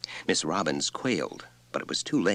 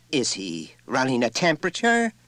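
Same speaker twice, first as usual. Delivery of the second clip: shrill; 7 semitones higher, 3 dB louder than usual. A narrator's reading. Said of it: "The mother's voice went shrill".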